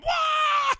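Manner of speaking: high, loud crowd whoop; a wild cheer from kids; excited kids' cheer. Retold or imitated.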